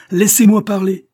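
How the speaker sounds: high, loud cut-in; sharp interruption. A narrator's reading. Betrayed husband cuts off Raoul, loud interruption. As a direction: cut him off hard. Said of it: "interrompit monsieur Fauvel".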